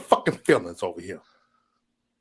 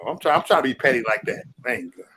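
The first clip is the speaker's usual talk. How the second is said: ordinary delivery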